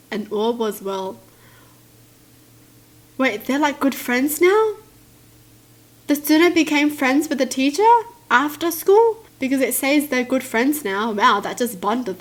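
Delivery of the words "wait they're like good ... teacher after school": higher, rising disbelief; surprised disbelief. Real, not acted. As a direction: ask it in total disbelief. Disbelief at story.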